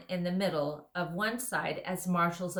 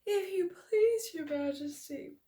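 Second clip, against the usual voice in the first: thick sleepy bear; 12 semitones higher than usual. Acted. Bear character voice.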